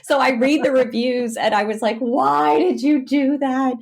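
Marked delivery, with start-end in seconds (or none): 2.0-3.7 mock lament. Retold regret at reading reviews.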